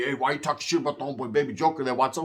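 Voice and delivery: a quick, confronting homie voice; an imitated homie calling him out, fast; mock challenger. Homie checks him over Baby Joker, confronting. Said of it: "somebody tried to check me".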